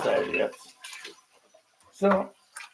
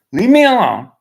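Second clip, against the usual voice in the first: loud, higher yell; retold shout; 7 semitones higher, 13 dB louder than usual. Quoting himself to the landlord, loud shout. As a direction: shout her away.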